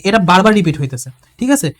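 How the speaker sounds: ordinary talk, nothing marked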